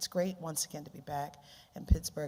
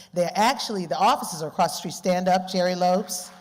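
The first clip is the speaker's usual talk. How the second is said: much louder, calling across the hall; 4 semitones higher, 10 dB louder than usual. Real, not acted. Speaker calling her colleagues to stand up, loud. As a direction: call them to their feet.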